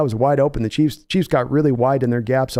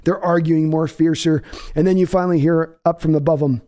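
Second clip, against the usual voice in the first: normal talking voice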